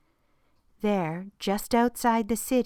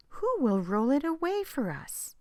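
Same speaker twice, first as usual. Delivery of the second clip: worried question; 7 semitones higher than usual. A narrator's reading. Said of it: "they asked each other".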